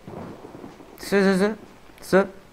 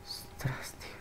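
breathy startled whisper; 24 dB quieter than usual. Real, not acted. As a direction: gasp it under your breath.

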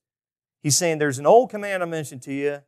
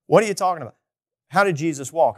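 startled objection; 2 semitones higher, 5 dB louder than usual. Retold or imitated. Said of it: "most of us hit the brakes and go".

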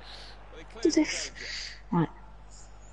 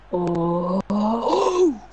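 rising "oh"; 4 dB louder than usual. Real, not acted. Gamer watching the ball go in, rising excited oohs. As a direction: let the "oh" swell upward in suspense.

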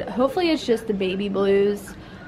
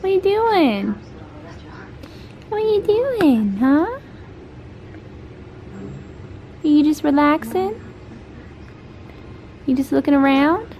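much higher, sing-song cooing; 7 semitones higher than usual. Real, not acted. Mom asking her relaxing baby what he's doing in baby talk.

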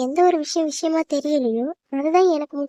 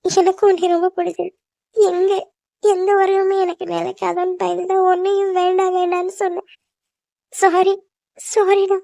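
higher-pitched, upset apology; tearful; 4 semitones higher, 3 dB louder than usual. Acted. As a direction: apologise through tears to someone you love. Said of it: "எதுக்கு இப்படி அழுகுற". (அழுகையோடு உயர்ந்த குரலில் மன்னிப்பு)

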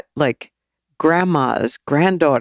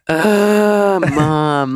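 exasperated groan, higher and louder; eye-rolling daughter; 3 semitones higher, 4 dB louder than usual. Retold or imitated. Daughter reacting to her mom's work, exasperated. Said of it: "a lot of eye rolling".

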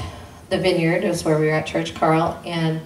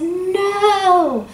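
anguished shout; 14 semitones higher, 6 dB louder than usual. Retold or imitated. Husband hits floor, anguished.

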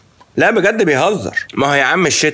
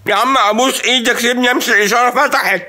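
frantic driver with the light turning green, half laughing; desperate pleading; 9 semitones higher than usual. Retold or imitated. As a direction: beg him to let you drive off. Desperate driver begging to leave.

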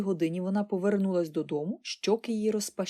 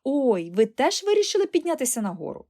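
higher, louder happy surprise; delighted; 5 semitones higher, 5 dB louder than usual. A narrator's reading. Girl seeing aunt follow her upstairs, delighted. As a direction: greet it with a gleeful burst. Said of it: "зраділа Поліана".

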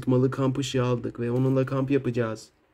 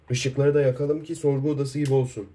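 ordinary talk

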